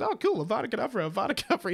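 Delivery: a quick, careless mock kid zapping everything; gleeful kid casting spells. Derek casually killing everything in the room, fast and gleeful. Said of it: "just goes".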